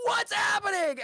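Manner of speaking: high, loud bewildered cry; acted high-pitched shout; crowd shout. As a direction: yell it out like a startled crowd.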